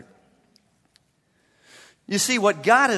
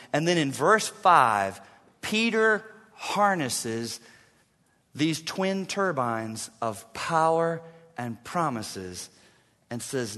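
usual speaking voice

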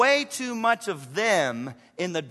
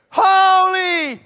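high, booming cry, drawn out; full-voiced proclamation; shouting; 12 semitones higher, 12 dB louder than usual. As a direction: belt it out like a shouting angel. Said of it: "they're shouting back and forth".

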